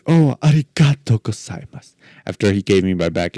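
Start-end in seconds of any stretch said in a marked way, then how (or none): 0.1-1.9 high, eager tourist thanking in Japanese. Retold or imitated. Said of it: "be like every tourist".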